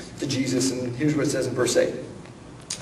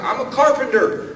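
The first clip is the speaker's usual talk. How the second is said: stranger's reply; 9 semitones higher, 4 dB louder than usual. Retold or imitated. The stranger answers.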